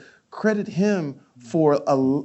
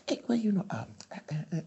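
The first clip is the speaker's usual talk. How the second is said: stuttering Ray; 3 semitones higher, 9 dB quieter, much faster than usual. Retold or imitated. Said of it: "he started to stutter".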